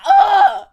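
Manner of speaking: loud, high groan of frustration; wordless exasperated outburst; exasperated ugh. Real, not acted. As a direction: burst out with a furious ugh. Exasperated growl mid rant.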